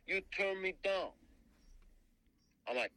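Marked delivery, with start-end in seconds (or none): 0.1-1.1 put-on high, brash New Jersey accent; Jersey guy. Jersey caller confronting the artist, high brash Jersey accent. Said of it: "he talks just like a guy from Jersey".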